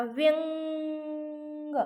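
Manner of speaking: व्यंग drawn out very slowly, nasal ङ held; slow demo pronunciation. Shown as a demonstration. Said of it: "व्यंग म्हणताना कशाचा उच्चार होतोय". (व्यंग सावकाश, अनुनासिक लांबवून)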